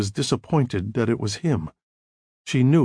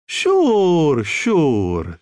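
high, loud Italian reassurance; heavy Italian accent; 4 semitones higher, 4 dB louder than usual. A narrator's reading. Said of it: "She had a heavy Italian accent".

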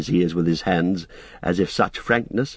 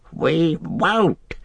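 high, defiant creature cry; Gollum crying out; 8 semitones higher than usual. Acted. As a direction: cry out in refusal. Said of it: "he cried".

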